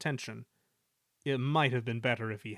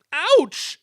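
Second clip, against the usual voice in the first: startled cry; 14 dB louder than usual. A narrator's reading. Crash jolts engine.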